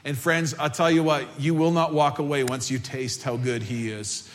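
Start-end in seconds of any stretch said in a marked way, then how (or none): none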